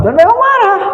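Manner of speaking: high-pitched burst of mock anger; mock angry outburst. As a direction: jump sharply up in pitch, imitating anger. Voices the parent's anger.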